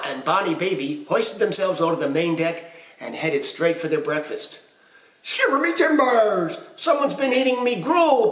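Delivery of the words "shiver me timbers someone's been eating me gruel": loud, high pirate papa outburst; big storybook pirate boom. A narrator's reading. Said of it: "Papa boomed".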